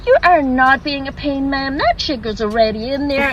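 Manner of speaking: reassuring American barista; American accent. Acted. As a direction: soothe the customer in a perky American voice. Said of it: "Sorry, forgive the accent".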